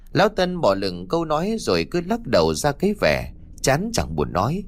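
ordinary delivery

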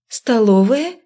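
puzzled rising question; surprised echo. Acted. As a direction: ask it back in surprise. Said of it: "переспросила я".

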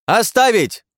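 high, loud yell to stop; screaming. Acted. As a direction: scream at them to halt. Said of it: "завопил я в теле Прыщавого".

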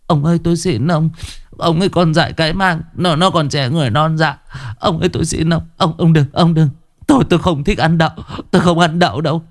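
high-pitched begging woman's voice; frightened mother begging to spare her son; pleading wife. A narrator's reading. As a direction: plead to calm the husband, stammering. Wife pleads with angry husband.

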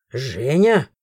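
low stern call; stern man. A narrator's reading. Chris finding the creatures, low stern. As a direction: call her name darkly.

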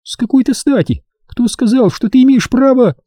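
very high-pitched defiant retort; outraged girl answering back; indignant challenge. A narrator's reading. Рен quarrels with her brother.

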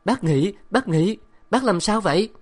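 high, loud frightened calling; panicked cry. Acted. Girl calling out, frightened of the gripping woman. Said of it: "Tôi hốt hoảng la to".

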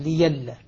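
ليلا said with ya, no hamza: li-yal-la; Warsh reading. Shown as a demonstration. Teacher demonstrating how Warsh reads ليلا with ya instead of the hamza. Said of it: "لئلا بدل لئلا".

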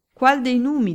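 high, loud, theatrical declamation; declaiming. A narrator's reading. Cecere playfully declaiming a verse to the baron, grandly. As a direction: recite it like a tragic actor on stage. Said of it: "declamò Cecere".

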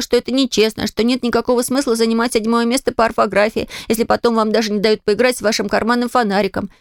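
ordinary delivery, nothing marked

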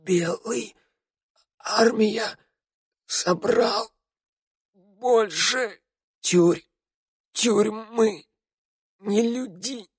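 broken, gasping words with long pauses; acted death rattle, high and strained; dying rasp. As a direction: squeeze out each word while dying. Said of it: "прерывистый хрип Раду".

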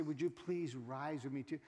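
soft, low, proper church invitation; hushed mock-formal courtesy; polite request. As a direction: imitate a decorous request to stand. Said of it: "they want you to say".

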